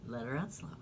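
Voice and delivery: very soft, sinking into a whisper; calm yourself on a long exhale; letting breath out. Real, not acted. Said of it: "take a deep breath".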